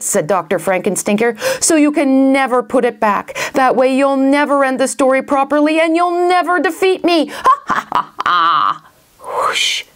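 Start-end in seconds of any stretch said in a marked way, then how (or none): 1.6-7.3 high, building villain boast; gloating villain. Acted. Villain gloats over heroes. 7.3-8.8 stagey triumphant cackle; villain cackle. Acted. 9.2-9.8 unvoiced, airy whoosh; whoosh noise. Narrator imitates a whoosh.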